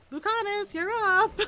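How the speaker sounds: high, loud sing-out to a companion; playful calling out. Pretend. Rook sends Lucanus into the fight, playful high call.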